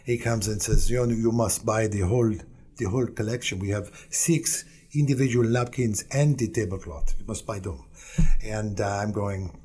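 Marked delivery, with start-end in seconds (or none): 0.9-7.8 weak fake French accent; a lousy put-on French accent. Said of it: "a fake French accent ... I'm gonna do a lousy job of it".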